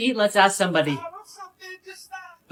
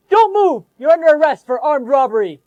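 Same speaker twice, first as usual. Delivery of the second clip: much higher, louder command; police shout; 5 semitones higher, 7 dB louder than usual. Acted. Officer to robber, loud arrest shout. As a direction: bark the arrest like a cop.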